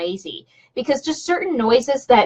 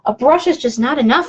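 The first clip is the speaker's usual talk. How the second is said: ordinary talk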